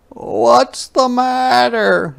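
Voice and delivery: high, slow gentle question; worried mother. Acted. Mama asks tearful Ruthie at bedtime, high and slow. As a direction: coax her to talk. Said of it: "asked Mama".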